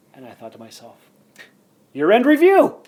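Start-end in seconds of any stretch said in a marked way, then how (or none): 1.9-2.8 loud, high, eager proclamation; excited announcement. Retold or imitated. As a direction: announce it like a big reveal. Announcing the show idea.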